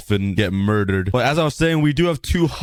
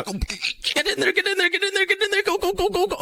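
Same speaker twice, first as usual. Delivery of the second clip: high-pitched cheering voice, softer; high squeaky urging; 4 dB quieter than usual. Pretend. Squeaky high urging at the Hoglin to get in. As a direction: egg the mob on in a squeaky high voice.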